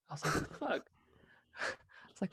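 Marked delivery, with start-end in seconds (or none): 0.3-0.9 much higher, soft, taken-aback squeak; startled. Retold or imitated. Startled by his friend yelling from the tent. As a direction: caught completely off guard.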